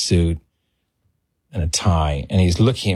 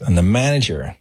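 ordinary talk, nothing marked

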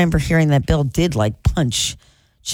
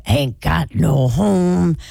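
[name]; much slower than usual. Retold or imitated. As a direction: croak it out slowly like a bullfrog singer. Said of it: "you know who I sound like".

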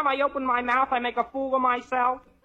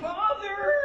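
high call into the house; 10 semitones higher than usual. Acted. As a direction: call out from the doorway. Calling from the front door.

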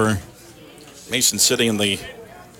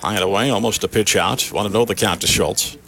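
radio baseball announcer. Acted. Live play-by-play call.

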